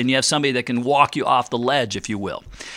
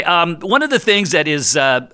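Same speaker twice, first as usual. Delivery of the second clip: usual speaking voice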